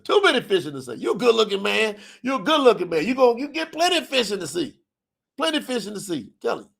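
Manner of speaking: hammed-up dad reassuring his son; daddy's voice. Daddy voiced giving advice.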